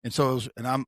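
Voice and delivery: ordinary talk, nothing marked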